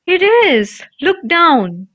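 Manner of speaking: high, loud excited yelp; yelping excitement. A narrator's reading. Ron yelps at Harry vanishing under cloak, excited. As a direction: blurt it out in sudden amazement. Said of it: "Ron gave a yelp".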